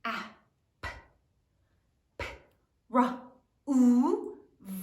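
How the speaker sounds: "Ap-, p-, ra-, u-, v" broken into isolated sounds with gaps; sounding it out. Shown as a demonstration. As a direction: separate phonics sounds for "Ap-, p-, ra-, u-, v". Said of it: "Sound it out".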